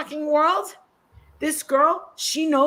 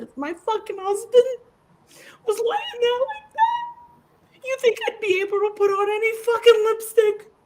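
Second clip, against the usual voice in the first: retold furious rant; 8 semitones higher than usual. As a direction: blow up in disgust at her. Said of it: "I fucking lost it when I was saying".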